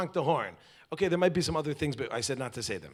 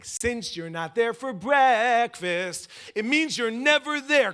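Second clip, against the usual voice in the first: slow whiny complaint; 9 semitones higher, 6 dB louder, much slower than usual. Retold or imitated. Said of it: "what was she saying".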